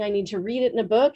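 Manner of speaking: quick, run-together "I need to read it in a book"; quick plea. Retold or imitated. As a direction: rattle the request off quickly. Student's own quick plea, later echoed.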